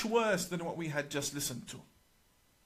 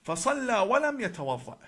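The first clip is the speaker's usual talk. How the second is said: higher, louder, quick Arabic recital; Arabic recitation; 5 semitones higher, 6 dB louder, much faster than usual. Shown as a demonstration. Speaker quoting the hadith's key line to viewers, emphatic Arabic recitation. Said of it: "I will recite in Arabic".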